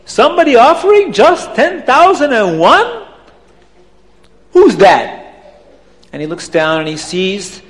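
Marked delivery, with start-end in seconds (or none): 0.0-5.3 high, swooping disbelief; outraged accented questioning; indignant Abdallah. Acted. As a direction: demand incredulously who dared. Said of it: "Abdallah says".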